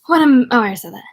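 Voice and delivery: ordinary delivery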